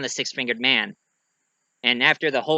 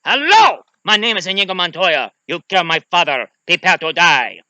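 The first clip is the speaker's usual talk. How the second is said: loud, rising-strength avenger's vow; Inigo Montoya; 3 semitones higher, 7 dB louder than usual. Acted. Wounded swordsman gathering strength, loud accented declaration. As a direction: belt out the vow, high on the first word. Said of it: "still is alive and still fighting".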